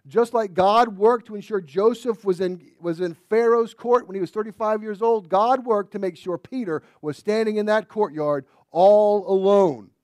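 loud, rising preacher voice; full-force preaching. Acted. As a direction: drive the point home.

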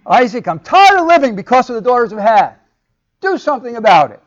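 high, loud demanding complaint; emotional outburst. Retold or imitated. Rebecca demanding Isaac act, loud and high. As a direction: throw a dramatic fit at him. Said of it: "Outburst of emotion".

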